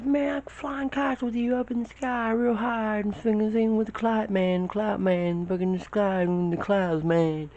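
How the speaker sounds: very high excited yell, easing toward the end; high strained shout mid-air; shouting over wind. Retold or imitated. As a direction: holler it across the sky.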